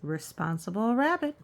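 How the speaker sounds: ordinary delivery, nothing marked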